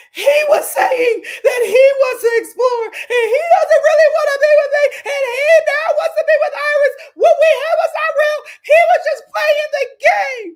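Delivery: high, loud, fast wailing sob; mock sobbing wail. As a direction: wail it out like a heartbroken girl. Huda sobbing to the girls.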